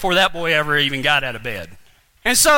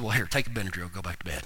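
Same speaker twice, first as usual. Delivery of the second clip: low, quiet dismissive reply; unmoved dad; 13 dB quieter, faster than usual. Retold or imitated. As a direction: send them off to bed, low and soft. Retells dad's unmoved late-night reply.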